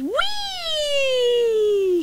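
high, slow stretched whee; gleeful whee. A narrator's reading. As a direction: let the whee glide down. Sliding again.

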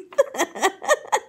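high burst of laughter; real laugh at own story; cracking up. Real, not acted. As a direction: laugh helplessly.

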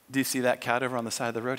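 low, quick, even murmur; voice in his head. Acted. The Lord asking about the cat, voice in his head. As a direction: drop the pitch and prompt him privately. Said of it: "he hears this voice in his head".

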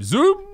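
playful high zoom effect; squeaky mock zoom noise; zoom sound. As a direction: sing out the zoom like a camera. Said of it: "I'm be zooming in all the time".

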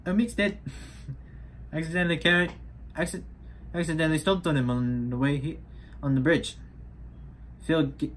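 mock-confessional New Zealand accent; Korg's New Zealand accent. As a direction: imitate a Kiwi accent, pitched a little up. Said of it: "That's this New Zealand accent".

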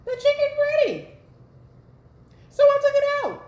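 naive younger self. Retold or imitated. Retelling her undercooked chicken, high naive voice. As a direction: chirp it out like an eager new cook. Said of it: "but I'm like".

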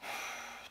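long, audible breath; deep breath. Shown as a demonstration. Meditation teacher showing how to de-stress, deep breath. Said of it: "take a deep breath".

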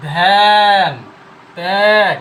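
"ধ্যান", "ত্যাগ" as dhaen, taeg, stretched and very loud; bent vowel. Shown as a demonstration. Teacher demonstrating bent pronunciation, "ধ্যান", "ত্যাগ" with long loud ae. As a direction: hold the ae loudly in "ধ্যান", "ত্যাগ". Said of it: "বেঁকে যে উচ্চারণটা হচ্ছে".

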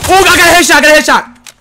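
high, loud gamer cheer; excited yell. Real, not acted. Gamer shouting over his headshot, excited.

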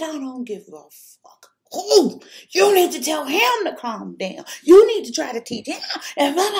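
high, loud, near tears; angry tearful yell. Retold or imitated. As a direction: yell through rising tears. Yelling at the mother-in-law.

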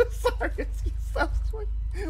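high giggling apology, slowed by laughter; laughing at himself. Real, not acted. Gamer laughing at his own tongue slip, giggling. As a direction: crack up at your own fumbled words. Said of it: "I can't even talk right".

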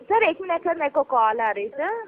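normal talking voice

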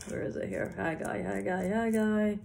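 very soft, half-voiced search, repeating the name; absent-minded searching. Real, not acted. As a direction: murmur to yourself while hunting for the page. Searching for the Bible passage.

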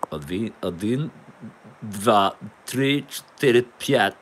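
slow Russian count, each number set apart; attempted Russian. Shown as a demonstration. Counting to eight to sound like Russian, put-on Russian. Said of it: "supposed to sound like Russian".

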